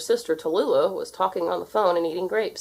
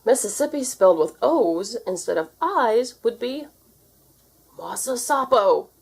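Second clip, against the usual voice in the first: blurted; 5 semitones higher, 3 dB louder than usual. A narrator's reading. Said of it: "blurted Uncle Jidlo".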